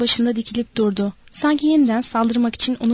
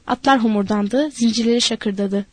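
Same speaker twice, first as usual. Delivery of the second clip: usual speaking voice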